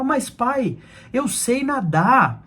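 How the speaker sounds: put-on high, drawn-out kid's whine to dad; retold plea, stretched and high-pitched; childish protest. Retells his childhood protest to father.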